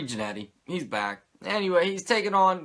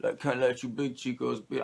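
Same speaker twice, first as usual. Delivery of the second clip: bad Razor impression; 4 semitones lower than usual. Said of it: "I can't do a fucking Razor impression".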